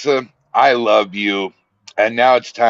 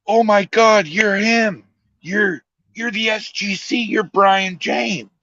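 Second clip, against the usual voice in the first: much higher, squealing fan voice, slowing on the name; imitating a starstruck fan; 12 semitones higher than usual. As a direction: gush like a fan who just spotted her idol. Retold fan recognizing him.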